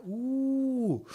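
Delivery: long, high-pitched mocking ooh; mock-wounded ooh. Streamer answering a Jake Paul jibe, mock-wounded ooh. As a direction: feign hurt with a drawn-out, high ooh. Mocks an insult as hurtful.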